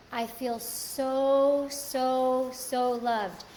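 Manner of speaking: each "so" stretched long and slow; emphatic, drawn-out SO. Real, not acted. Host describes being heard by partner, drawn-out stress on "so". Emphasizing how loved they feel.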